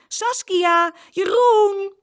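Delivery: high, loud searching shout; repeated calling. A narrator's reading. Father walking the street, calling out for the twins. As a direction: shout each name out to carry far. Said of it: "riep steeds".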